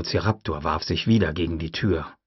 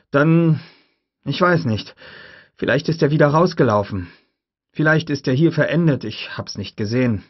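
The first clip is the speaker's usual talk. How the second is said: higher, louder nervous man; shaken Gennaro; 5 semitones higher, 4 dB louder than usual. A narrator's reading. Gennaro answering Grant about the raptor, shaken. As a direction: answer in a rattled rush.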